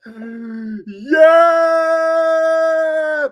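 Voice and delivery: long, high, loud drawn-out yeah; arena cheer; long cheering yell. Real, not acted. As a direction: roar like the crowd.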